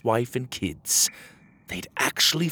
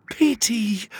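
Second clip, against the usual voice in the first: wife's reply; 11 semitones higher, 4 dB louder than usual. Acted. Wife answering Petey.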